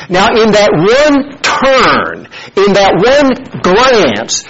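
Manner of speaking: slow, deliberate, leaning on one; slow emphatic point. Acted. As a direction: spell out the key moment slowly. Preacher stressing key point.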